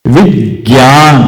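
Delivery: विज्ञान said slowly, weight on वि before the ज्ञ; stressed first syllable. Shown as a demonstration. Teacher to students, slow demo of विज्ञान. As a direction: stretch विज्ञान, pressing the वि. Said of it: "इसको बोलते हैं विज्ञान".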